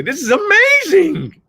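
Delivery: a drawn-out, booming "amazing"; Joseph McClendon impression. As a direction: ham up the big stage hype-man wonder. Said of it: "as Joseph McClendon would say".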